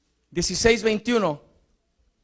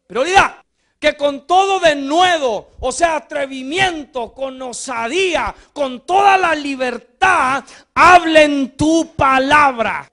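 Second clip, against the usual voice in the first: loud, higher, slowed preaching; preaching shout; 5 semitones higher, 6 dB louder, slower than usual. Acted. Preacher urging the church to speak boldly, loud and slow. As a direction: stretch each phrase out in full cry.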